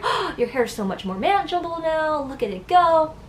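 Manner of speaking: delighted mom. Retold or imitated. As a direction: gush like a thrilled mother.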